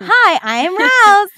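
high, loud, slow shouted hello; shouted greeting. Retold or imitated. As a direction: shout the intro eagerly. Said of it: "I'm like".

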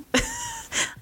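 very high, soft laugh; small high-pitched giggle. Real, not acted. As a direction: laugh softly at yourself. Laughs at own retold answer.

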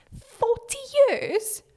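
slow, sky-high incredulous "Forty years?"; mock astonishment. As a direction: gasp it out as if it's unthinkable. Exaggerated disbelief at forty years.

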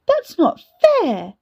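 put-on high, slow, wailing little girl; little sister wailing at the unfairness; child's protest. A narrator's reading. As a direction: whine it like a wronged child.

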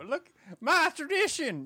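high, loud, slow Southern caricature; mock Southern accent. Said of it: "Southern accent comes from the British accent".

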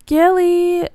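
high, loud call across the water; yelling a name. Acted. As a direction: shout her sister's name. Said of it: "Brooke yelled".

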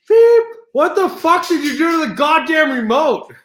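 very high, loud outraged shout; furious retold yell. Yelling at the host over the changed channel, furious retold shout. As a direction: re-enact yelling in total outrage. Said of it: "I yelled at her".

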